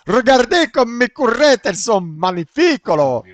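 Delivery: loud, high fake Italian accent in French; hammed-up Italian accent; mock Italian accent. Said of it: "Il a un mix d'accent".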